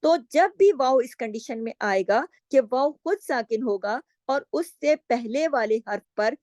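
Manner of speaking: normal talking voice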